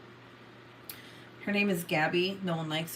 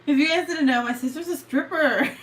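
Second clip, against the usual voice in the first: high, loud playful reveal; teasing announcement; 5 semitones higher, 4 dB louder than usual. Real, not acted. Exposing sister to the viewers, loud teasing announcement.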